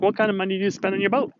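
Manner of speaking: can't believe what he just heard; shocked question to the boat-loving caller; incredulous. Retold or imitated.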